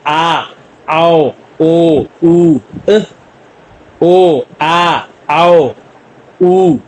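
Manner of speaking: very slow, stretched आ आओ ओ ऊ अ and या याओ यो यू; teacher sounding out vowels; vowel drill. Shown as a demonstration. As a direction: model each Korean vowel clearly for learners. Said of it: "पहला लफ्ज मैं आपको प्रोनाउं".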